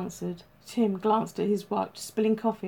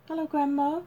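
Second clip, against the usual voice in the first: put-on high, sweet little-boy voice; innocently, like a small boy; innocent child; 7 semitones higher than usual. A narrator's reading. Small boy greets his grandmother, innocent. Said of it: "he said innocently".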